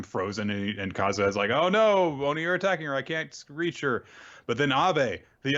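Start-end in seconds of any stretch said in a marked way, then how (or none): 1.5-4.0 mock panic. Retelling Kazuha seeing Reno attacked by Oni, in mock panic. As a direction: imitate a hero crying out helplessly. Acting out hero's panicked cry.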